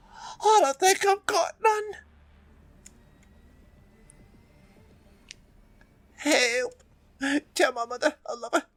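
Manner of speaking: high, pained dying man's voice; wounded soldier gasping. Acted. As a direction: gasp it out like you're bleeding out.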